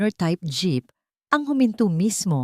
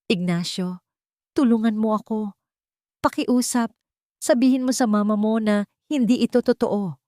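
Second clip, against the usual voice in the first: voice cracking with tears; 5 semitones higher than usual. A narrator's reading. Wife begging her husband for help, tearful voice. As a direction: beg him through breaking sobs. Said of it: "ang boses ay basag sa pagiyak".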